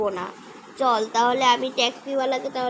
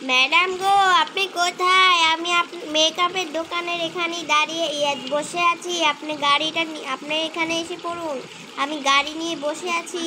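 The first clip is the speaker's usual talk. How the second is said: taxi driver calling; 3 semitones higher than usual. Acted. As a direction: call out to find the madam in the rain.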